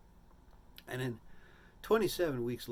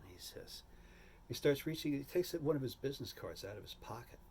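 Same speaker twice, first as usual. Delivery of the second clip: ordinary talk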